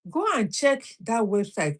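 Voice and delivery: ordinary talk, nothing marked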